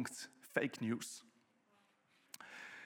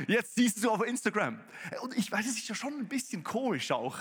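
ordinary talk

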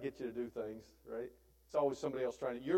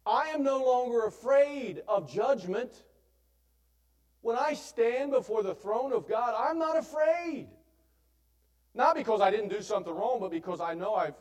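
full-force sermon cry, unafraid before the throne; fervent preaching; 8 semitones higher, 7 dB louder than usual. Acted.

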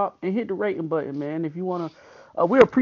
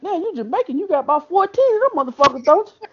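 mock incredulous; 10 semitones higher, 4 dB louder, faster than usual. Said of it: "I'm like".

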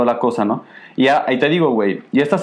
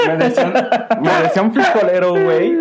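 laughing; 8 semitones higher than usual. Real, not acted. Laughing recalling insult.

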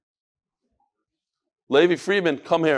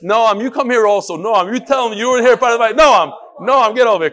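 loud, urgent summons by name; calling out; 5 semitones higher, 8 dB louder than usual. Real, not acted. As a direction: call him over, pushing hard. Summoning Noam before the group.

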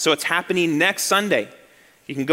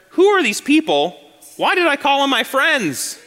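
higher and louder, laughing at the absurdity; exasperated host; 8 semitones higher, 3 dB louder than usual. Retold or imitated. Host watching guests leave, laughing exasperation. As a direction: throw your hands up at these guests. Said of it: "You start to wonder".

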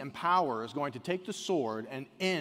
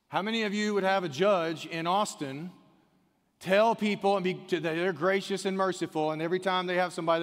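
higher and louder impassioned preaching; preaching at full force; 7 semitones higher, 8 dB louder than usual. Acted. Pastor challenging the congregation about a judge, fiery preaching. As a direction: drive the point home to the crowd.

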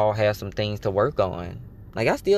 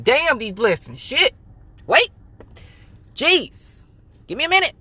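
high, loud mock-overwhelmed yelps; high-pitched playful exclamations, pausing between each; 14 semitones higher, 5 dB louder than usual. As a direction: play being swamped, in high bursts. Playacted being swamped by sudden blessings.

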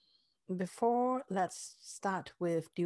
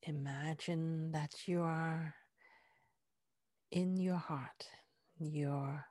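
guided meditation; 2 semitones lower, 3 dB quieter than usual. Acted. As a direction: ease them inward with soft breathy words. Heart-focus guided meditation.

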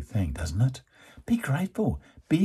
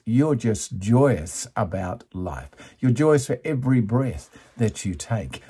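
ordinary talk

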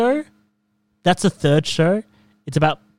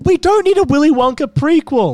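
exasperated protest; 13 semitones higher, 7 dB louder than usual. Real, not acted. Dismissing the Wonka movie.

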